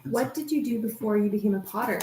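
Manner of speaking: ordinary talk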